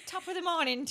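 high-pitched Irish attempt; Irish accent. Shown as a demonstration. Reluctant host trying on request, Irish accent. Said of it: "Do Irish".

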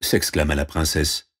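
ordinary delivery

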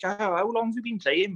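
normal talking voice

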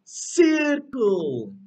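Circle said loud and drawn out for the class to copy; teacher modelling Circle for the class, loud and clear; clear model word. Shown as a demonstration. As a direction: model Circle slowly for children to repeat. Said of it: "Ayúdenme a repetir".

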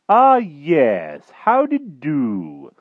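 high, loud, drawn-out greeting; effusive knight. A narrator's reading. King greets magician once he understands, effusive. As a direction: stretch it out with hearty relief.

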